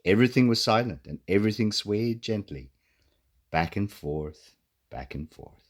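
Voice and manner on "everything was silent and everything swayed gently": ordinary delivery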